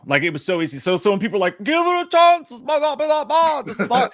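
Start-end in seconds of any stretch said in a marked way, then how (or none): 1.6-4.1 high, pleading whine; mock the fans in a squeaky plea; whiny high mock voice. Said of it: "you sound like some motherfucking drunk guy".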